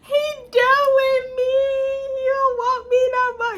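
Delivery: high, drawn-out comic lament; mock wailing. Girlfriend lamenting that he is done with her, mock wailing. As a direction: wail it like a heartbroken drama queen.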